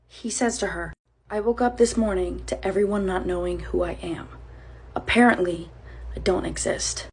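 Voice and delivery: a hint of a weary stranger, kept low; lightly voiced stranger. Stranger at her car talking.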